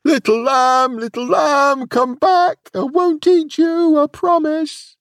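high, loud, desperate calling; crying out. A narrator's reading. Wolf searching the dark wood for the lamb, crying out. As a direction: call far into the distance, pleading.

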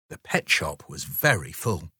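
ordinary delivery, nothing marked

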